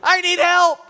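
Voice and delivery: a high, loud, desperate scream, word by word. Retold or imitated. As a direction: scream it out, drawn apart in panic. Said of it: "And I screamed it".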